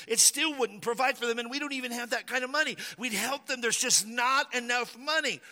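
a fretful follower begging Jesus; worried disciple. Acted. Disciple arguing there's no money.

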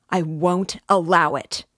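a full loud shout of I won't allow it. A narrator's reading. Raskolnikov shouting again at Porfiry. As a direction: throw it at him. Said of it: "Raskolnikov shouted again".

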